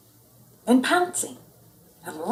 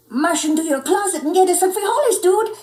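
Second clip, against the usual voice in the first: much higher, louder, fast punchline; cheeky chihuahua voice; 9 semitones higher, 6 dB louder than usual. Acted. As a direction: fire off the joke.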